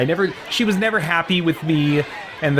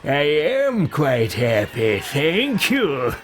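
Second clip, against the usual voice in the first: slow, drawn-out mock politeness; overly sweet villain courtesy, stretched out; smug game character; much slower than usual. Character answers ally with mock politeness.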